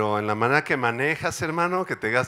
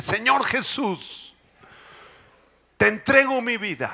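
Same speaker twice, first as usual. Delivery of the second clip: loud, slow heartfelt prayer; fervent prayer; 3 semitones higher, 4 dB louder, slower than usual. Acted. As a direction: lead the church in surrender. Leads prayer. (oración ferviente en voz alta)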